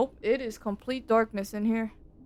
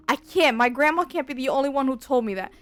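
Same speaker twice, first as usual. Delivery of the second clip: ordinary talk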